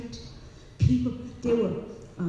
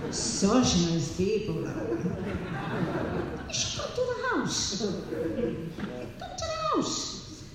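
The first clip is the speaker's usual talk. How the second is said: imitating mother; 4 dB quieter, slower than usual. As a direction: coax like an old mother. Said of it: "mother continues speaking".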